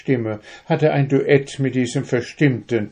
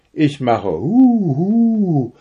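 owl hooting; 8 semitones higher, 6 dB louder, slower than usual. A narrator's reading. Owl imitates his hoot.

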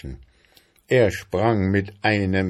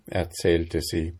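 usual speaking voice

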